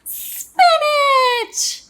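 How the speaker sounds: put-on very high, loud child's squeal; delighted squeal. Little Pea sees dessert, squealing high and loud. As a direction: squeal it with glee, high and long. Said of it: "squealed Little Pea".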